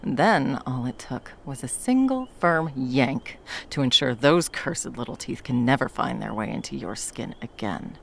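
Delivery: ordinary talk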